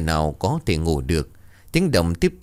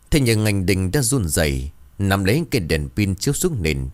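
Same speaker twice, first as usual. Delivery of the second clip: ordinary delivery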